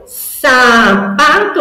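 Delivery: teacher revealing sapato; triumphant reveal. Shown as a demonstration. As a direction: announce the new word to a child with delight. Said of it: "Que palavra que vai virar".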